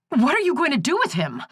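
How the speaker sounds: high, fast and panicked; a frightened sister pleading with the sheriff, high and rushed. A narrator's reading. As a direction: blurt it out in a rush of fear. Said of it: "Nora asked in a panic".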